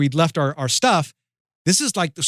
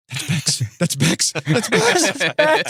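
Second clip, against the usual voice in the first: tip off your buddy, getting more excited each time; starstruck nudging; much slower than usual. Retold or imitated. Storyteller nudging the guy next to him on spotting Beckham, starstruck.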